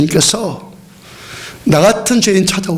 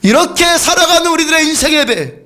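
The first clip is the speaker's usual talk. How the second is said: fervent preaching; 8 semitones higher than usual. Acted. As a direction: preach it out with rising fire. Preaching at full force.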